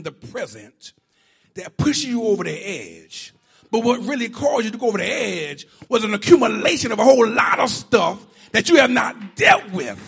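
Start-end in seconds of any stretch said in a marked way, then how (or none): 3.7-10.0 loud, building preacher's cry; preaching. Real, not acted. Preacher presses the congregation, loud and building. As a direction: drive the point home to the church.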